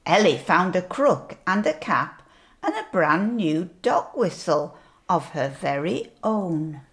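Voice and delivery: ordinary delivery, nothing marked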